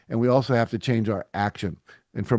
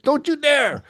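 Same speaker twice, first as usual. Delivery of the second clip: much higher, sharp indignant retort; mock outraged yelp; 4 dB louder, slower than usual. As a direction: fire it back, shocked and scolding. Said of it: "I said".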